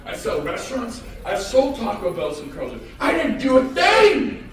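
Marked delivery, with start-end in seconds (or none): 3.0-4.5 slow, high, loud declaration; emphatic shout. Real, not acted. Speaker boasting to the crowd about his partner, slow shout. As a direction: hammer each word with a yell.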